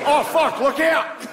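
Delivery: high-pitched mock Australian alarm; cartoonish Australian office worker panicking; panicked Australian. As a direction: cry out in a hammed-up Australian accent. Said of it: "an Australian guy in the office on nine eleven".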